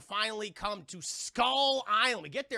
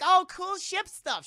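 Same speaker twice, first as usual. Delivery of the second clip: high, slow airhead voice; ditzy girl voice; 10 semitones higher, 9 dB louder, slower than usual. Retold or imitated. Voicing Fay Wray mockingly.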